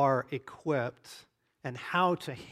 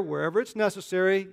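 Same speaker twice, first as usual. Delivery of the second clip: ordinary delivery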